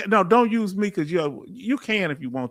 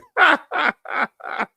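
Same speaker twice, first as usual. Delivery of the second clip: real high-pitched cackle; teasing laugh; 10 semitones higher, 3 dB louder than usual. Real, not acted. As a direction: burst into a long, high laugh. Laughs after teeing off Doc.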